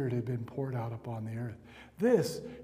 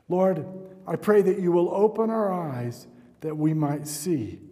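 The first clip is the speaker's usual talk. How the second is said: rising, louder pastoral prayer over music; fervent prayer; 5 dB louder than usual. Real, not acted. Pastor praying over the church, fervent and rising. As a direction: pray it with building authority.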